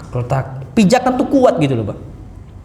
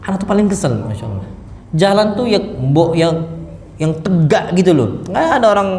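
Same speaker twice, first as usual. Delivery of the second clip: ordinary talk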